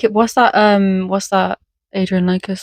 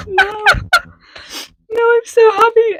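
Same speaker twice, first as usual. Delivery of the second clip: mock crying. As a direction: wail it like a weeping patient. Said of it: "And they're like".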